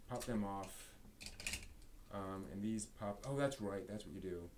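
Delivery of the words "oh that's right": usual speaking voice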